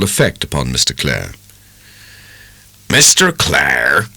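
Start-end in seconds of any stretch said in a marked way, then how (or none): 2.9-4.1 slow, weighty rustic dairyman voice; emphatic old dairyman, deliberate. A narrator's reading. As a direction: lean on it like a blunt country farmer. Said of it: "said the dairyman emphatically".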